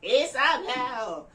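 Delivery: high, slow shout of her own name; shouted over her shoulder, drawn out; yelled name. Retold or imitated. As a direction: fling her name back while running away. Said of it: "yelled as she ran".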